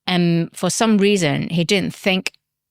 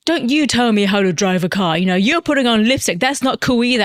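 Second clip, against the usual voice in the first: huffy taxi driver; 3 semitones higher, 3 dB louder than usual. Retold or imitated. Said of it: "he bunched up his knickers".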